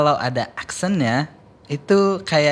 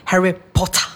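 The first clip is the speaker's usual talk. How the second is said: Malfoy British accent; 4 semitones higher than usual. Shown as a demonstration. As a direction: sneer it like Malfoy. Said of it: "Malfoy accent".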